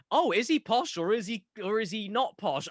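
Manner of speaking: a hint of a puzzled listener's voice; mock posh voice. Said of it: "high up there and very kind of".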